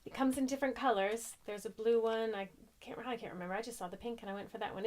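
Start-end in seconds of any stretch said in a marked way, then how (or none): none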